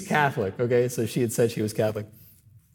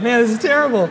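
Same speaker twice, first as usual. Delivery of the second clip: aghast thought; 11 semitones higher, 7 dB louder than usual. Retold or imitated. Priest's inner thought, aghast.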